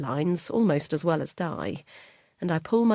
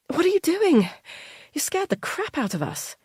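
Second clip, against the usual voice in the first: higher, louder shocked telling-off; startled scolding; 11 semitones higher, 4 dB louder than usual. Acted. Hannah scolds Charlie for scaring them, startled. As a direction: jump out of your skin.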